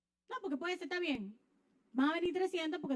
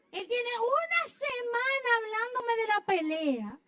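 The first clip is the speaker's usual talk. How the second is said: higher, louder, slow mock lament; mock wail; 7 semitones higher, 4 dB louder, slower than usual. Wife complaining her husband abandoned the fight, mock wail.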